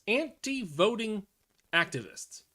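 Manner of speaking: anti-voting drawn out high and slow, mocking; incredulous mock emphasis. Real, not acted. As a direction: stretch anti-voting out in disbelief.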